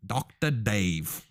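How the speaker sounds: slow, drawn-out "Doctor Dave" in mock Australian; bad Australian accent. Shown as a demonstration. Showing co-host how to say the nickname, bad Australian accent. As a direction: stretch it out in a hammy Aussie accent. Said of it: "you gotta say it like that".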